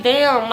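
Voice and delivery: loud pained damn; pained groan. Retold or imitated. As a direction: groan it out in pain. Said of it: "pain noises".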